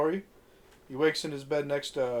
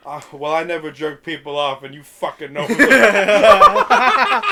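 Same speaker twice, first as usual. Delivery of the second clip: slow, louder line breaking into laughter; laughing quote; 15 dB louder, slower than usual. Retold or imitated. Zach answers his girlfriend, laughing through. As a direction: quote him while cracking up. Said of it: "Zach has the second best line".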